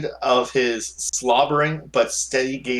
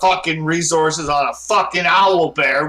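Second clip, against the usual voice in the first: angry rant; 5 semitones higher, 5 dB louder than usual. Acted. Baron rants about priorities.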